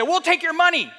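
loud, high-pitched mock threat; hammed-up bullying. Acts out state threatening county.

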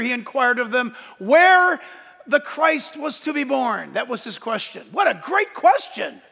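projected, high-energy pulpit voice; forceful preaching. Acted. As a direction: proclaim the verses to the back row. Preaches the text at raised force.

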